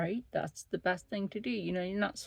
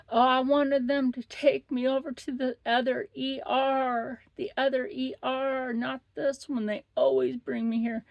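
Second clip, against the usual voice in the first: mock whine, higher; entitled whiny patient; 5 semitones higher, 4 dB louder than usual. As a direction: nag about the other ER. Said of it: "She's like".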